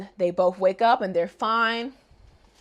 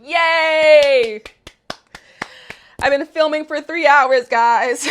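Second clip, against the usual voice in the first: loud, high cheer; excited; 6 semitones higher, 7 dB louder, slower than usual. Real, not acted. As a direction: celebrate the finish. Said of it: "it's the last episode".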